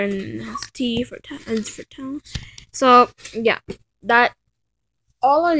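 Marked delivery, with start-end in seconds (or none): 0.0-2.2 whispered aside. Real, not acted. Remark on the book cover.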